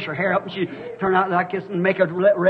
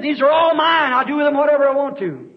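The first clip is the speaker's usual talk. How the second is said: raised, high preaching cry; loud mock boast as Satan; loud boastful declaration; 6 semitones higher, 5 dB louder than usual. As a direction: proclaim it like a possessive boast.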